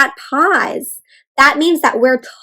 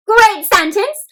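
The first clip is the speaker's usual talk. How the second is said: excited praise; 8 semitones higher, 5 dB louder, slower than usual. Real, not acted. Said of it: "praise after child says sentence".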